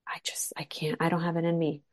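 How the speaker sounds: drained, breathless whisper; whispering, too tired to speak; exhausted whisper. Retold or imitated. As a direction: whisper it like there's nothing left. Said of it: "that I'm like".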